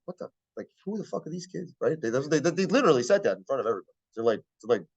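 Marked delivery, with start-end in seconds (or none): 0.1-1.7 much softer, acted-out aside; hushed disbelief. Leaders to each other about new students, hushed disbelief. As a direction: keep it quiet between colleagues. Leaders privately question the kids.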